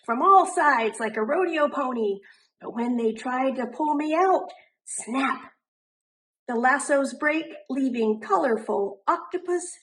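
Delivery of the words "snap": plain reading voice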